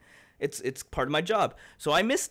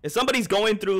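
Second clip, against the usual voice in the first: ordinary talk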